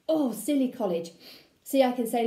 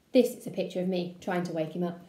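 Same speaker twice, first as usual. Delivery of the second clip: ordinary delivery, nothing marked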